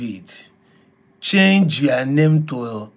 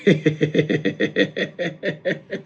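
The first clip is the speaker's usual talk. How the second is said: soft laugh; 7 dB quieter than usual. Real, not acted. Listener laughing.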